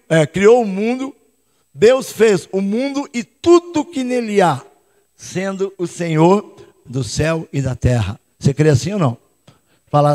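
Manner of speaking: ordinary talk, nothing marked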